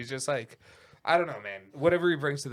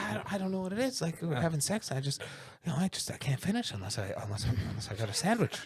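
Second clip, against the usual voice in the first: quick, neurotic mumbling confession; George Costanza impression; 2 semitones higher, 6 dB quieter than usual. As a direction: fret fast like George. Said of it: "When he's just like".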